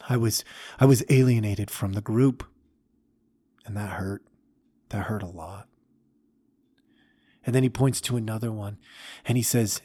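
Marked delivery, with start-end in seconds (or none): 3.6-5.7 soft, breathless whisper of hurt; whispered pain. Acted. Old man telling the young man about his childhood hurt, in a whisper. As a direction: let the hurt fall to a whisper.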